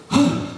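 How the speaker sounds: acted high gasp of excitement; quick excited intake of breath; eager gasp. Little sister spotting the boat, mock eager gasp. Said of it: "so eager to get on this boat".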